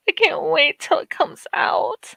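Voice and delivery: breathy whisper of eager anticipation. Real, not acted. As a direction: whisper it like a secret you can't hold in.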